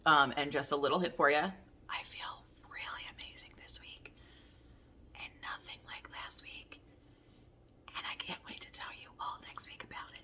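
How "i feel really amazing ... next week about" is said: whispered secret to the viewers; hushed teaser for next week; teasing whisper. Real, not acted. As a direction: lean in and whisper the hint.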